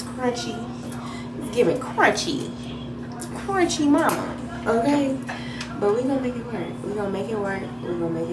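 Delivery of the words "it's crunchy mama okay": normal talking voice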